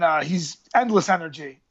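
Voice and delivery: ordinary talk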